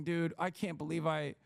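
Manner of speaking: ordinary delivery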